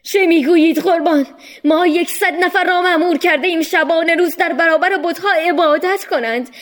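high, loud protesting plea; high, loud exasperated servant; desperate shout. Acted. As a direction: cry out in helpless frustration. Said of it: "یکی فریاد زد".

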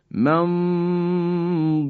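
ممدودین recited with a hidden nasal n before ض; ikhfa recitation. Shown as a demonstration. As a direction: Quranic recitation with a nasal hum. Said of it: "دقت بفرمایید". (تلاوت با غنه و اخفا)

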